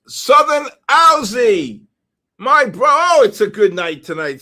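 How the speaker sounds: loud, delighted winner announcement; thrilled shout; excited announcing. Real, not acted. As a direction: cry out for a friend who just won. Announcing a friend as raffle winner.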